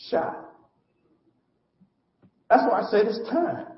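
ordinary delivery

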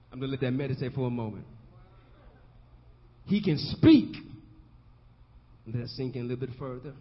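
ordinary talk, nothing marked

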